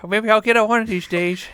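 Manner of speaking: put-on shaky old senior citizen; old man voice. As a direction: play a creaky old man. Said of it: "I'm like".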